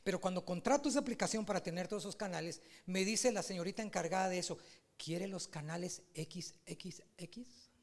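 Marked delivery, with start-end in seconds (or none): 5.0-7.7 slow, secretive whisper; whispered quote. Retold or imitated. Saleswoman offering the adult channels, slow secretive whisper.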